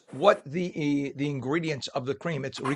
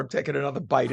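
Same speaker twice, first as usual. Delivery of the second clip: ordinary talk, nothing marked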